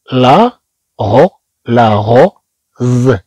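drawn out syllables, la-go-z; slow syllable by syllable. Shown as a demonstration. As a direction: sound out لاغوز slowly, one syllable at a time. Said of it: "إذن نقول".

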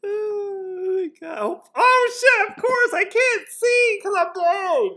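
high, breaking laugh-cry; real tearful laughter, high and loud. Real, not acted. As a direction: still helpless with laughter. Still laughing, can't see ticket.

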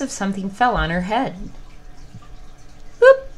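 lively storybook read-aloud; animated storytime narration; story narration. A narrator's reading. Reading story to children.

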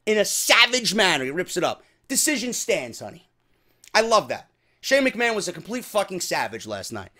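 usual speaking voice

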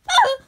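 loud, high mock scream of fright; fake scream. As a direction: shriek as if startled. Scared by the word blind.

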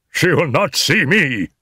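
high, agitated outburst; anguished cry. A narrator's reading. Husband shut out by his wife, crying out. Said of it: "cried Ferguson".

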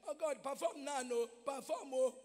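loud demanding plea to God; confrontational prayer. Retold or imitated. Worshipper mocking how people loudly demand from God. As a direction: shout at God to perform now. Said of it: "this is how we normally talk".

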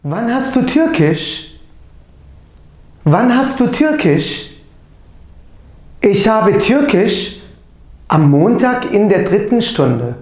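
teacher reading German aloud, deliberate and loud; pronunciation drill. Shown as a demonstration. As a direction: say each German phrase clearly for the class to repeat. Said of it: "bu görmüş olduklarımızı doğru telaffuzuyla tekrarlayalım".

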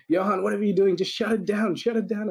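high-pitched, urgent retold phone plea; mock urgent demand. Client phoning the marketer to halt a campaign, mock urgency. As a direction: imitate an overwhelmed client calling in alarm.

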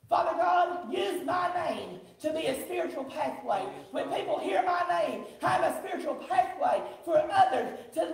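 high, loud declaimed prayer; urgent, full-voiced church prayer; fervent prayer. Real, not acted. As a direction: cry out to God for the whole congregation. Leads a prayer aloud.

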